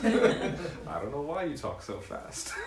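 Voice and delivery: slow teasing drawl through a laugh; mock slow teasing. Speaker teasing Ray, slow and laughing. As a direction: tease him, slowing everything down. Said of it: "We should talk like this always".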